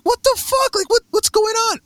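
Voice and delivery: high, startled cry of disbelief; shocked disbelief. Retold or imitated. Telling a friend about failing the dream test, stunned and high. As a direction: squeal it out like you just got blindsided.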